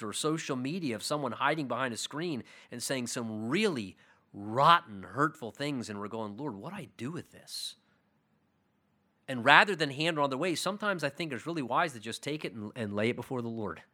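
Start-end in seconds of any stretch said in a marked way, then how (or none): 6.4-7.8 much softer, lost plea; hushed helpless prayer. Retold or imitated. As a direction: quietly ask God what to do. Believer voiced praying over hurtful text.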